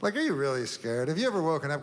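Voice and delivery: ordinary talk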